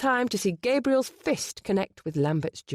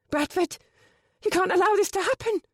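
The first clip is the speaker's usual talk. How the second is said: desperate plea; 13 semitones higher, 4 dB louder than usual. A narrator's reading. Penny pleads with brother.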